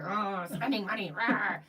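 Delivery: lightly voiced grumbling husband; a hint of a grouchy husband scoffing; grumpy husband. Wife mimicking her money-hating husband, mild grumble. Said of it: "He's like".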